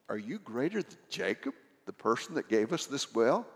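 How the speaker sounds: soft, dry sarcastic jab; mock him quietly and drily. Woman needling Jesus at the well, sarcastic. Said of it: "probably meant sarcastically".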